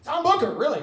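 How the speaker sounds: amused disbelief at the name; mock-surprised repeat of Tom Booker; mock surprise.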